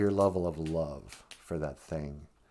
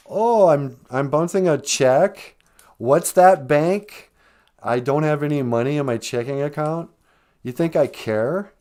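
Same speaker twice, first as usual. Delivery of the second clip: louder, mock-breezy brush-off to the bank; careless scoff; 6 semitones higher, 7 dB louder than usual. A grieving pet owner scoffing at the bank, louder and mock-breezy. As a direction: toss it off like nothing matters anymore. Acting out not caring after loss.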